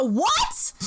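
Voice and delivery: loud, high yelp of disbelief; acted-out outraged shock; incredulous outburst. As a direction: blurt it out, utterly appalled. Retelling stunned reaction to apology.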